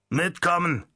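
curt command. Acted. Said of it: "befahl der Mann".

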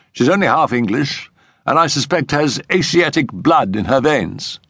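a high-pitched friend telling the family history; Settle's explanatory voice. Acted.

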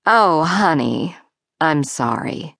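low, slow motherly sympathy; unsurprised sympathy. A narrator's reading. Mother comforts her daughter without surprise, low and sympathetic. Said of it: "Not even surprised".

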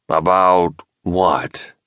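very slow, drawn-out question; bewildered, spelling it out; puzzled. A narrator's reading. Puzzled professor questions James.

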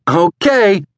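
put-on high, loud, eager child's cry; excited child. A narrator's reading. Lion agreeing before tearing down the hallway, high excited shout. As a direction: chirp it loudly like a boy raring to go. Excited boy answers, then tears off.

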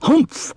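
high grumpy snort; dismissive camel grunt; camel humph. Acted. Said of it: "said the camel".

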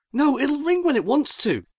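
exasperated. A narrator's reading. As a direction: explain it with rising exasperation. Paul exasperated with his demon.

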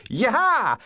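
high country and western whoop; whooped square-dance call; square-dance caller. A narrator's reading. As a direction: let out a hoedown holler. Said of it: "imitation of a country and western square dance".

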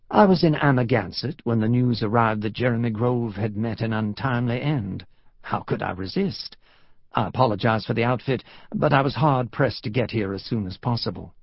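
put-on refined Southern gentleman's accent; genteel American South accent; cultured Southern drawl. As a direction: explain courteously in a Southern drawl. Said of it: "the cultured Southern drawl he remembered".

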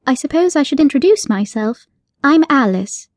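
put-on a polite girl demanding her cat back; firm yet polite young heroine; young Alice. Alice character voice.

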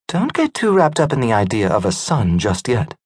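lightly voiced wife, teasing. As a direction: gently tease him about a son. Wife teasing her husband.

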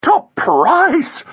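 very high, drawn-out squeal of triumph; piercing society-woman exclamation; shrill cry. A narrator's reading. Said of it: "cried Flossie shrilly".

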